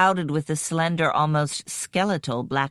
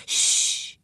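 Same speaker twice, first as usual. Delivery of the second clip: sharp unvoiced shush; hissed shush. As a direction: hiss it to silence someone. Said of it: "leaned forward and hissed".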